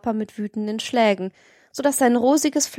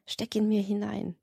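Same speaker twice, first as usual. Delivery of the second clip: said softly; 8 dB quieter than usual. A narrator's reading. The brother speaks softly to his sister, quiet voice. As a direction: murmur it softly to her ear. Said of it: "indem er leise zu seiner Schwester sagte".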